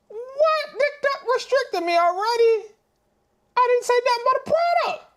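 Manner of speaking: high squeaky disbelief; stunned falsetto outrage; shocked. Real, not acted. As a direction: shriek it in disbelief. Shocked at TikTok restriction.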